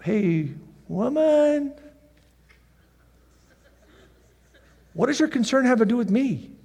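high, drawn-out "Hey, woman" then casual; Jesus brushing off his mother, high and slow; casual son. Acted. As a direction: shrug it off like a laid-back teen.

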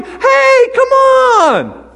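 very high, loud beckoning; eager welcome. Retold or imitated. Paul now welcoming hardship, high and loud. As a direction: call the trouble on gladly. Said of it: "to where he's going".

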